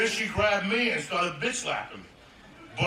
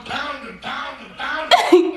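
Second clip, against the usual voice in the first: building pounding; 5 semitones higher, 9 dB louder, much slower than usual. Retold or imitated. Building repetition.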